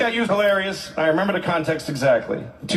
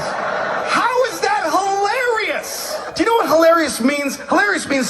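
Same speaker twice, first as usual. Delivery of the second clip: outraged rant; 10 semitones higher, slower than usual. Real, not acted.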